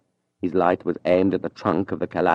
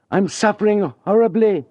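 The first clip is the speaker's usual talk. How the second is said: faked shout; 13 semitones higher, 3 dB louder than usual. Julien pretending poisoning to wake the house, hammed-up shout. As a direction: fake the agony to wake the house. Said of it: "he shouted".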